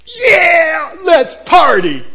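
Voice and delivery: high, loud, whooping party yell; partying shout. Retold or imitated. Steward cheering loudly once the master is gone. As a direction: cheer like a servant whose master just left. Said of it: "I don't expect the steward to go like this".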